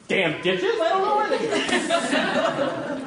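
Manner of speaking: husband dodging his wife's dishes question, squeaky laughing excuse; mock flustered excuse. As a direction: play the caught-out husband, squeaky and dragged out.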